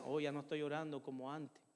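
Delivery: a hint of a guilty conscience nagging, said quietly; lightly voiced conscience, hushed and rueful. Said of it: "Y empieza mi conciencia".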